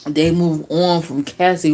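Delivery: ordinary delivery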